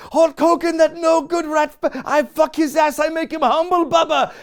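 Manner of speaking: very high, loud, furious impression; strained high-pitched shouted tirade; Iron Sheik rant. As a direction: imitate an unhinged wrestler going off. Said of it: "as he's ranting".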